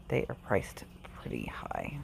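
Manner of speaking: hushed disapproving whisper. Real, not acted. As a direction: whisper it like a complaint. Aside about high prices.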